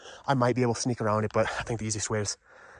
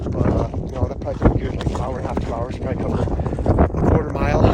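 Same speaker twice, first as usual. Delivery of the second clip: raised, louder voice in gusts; shouting over wind; 9 dB louder than usual. Real, not acted. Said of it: "It's kinda windy".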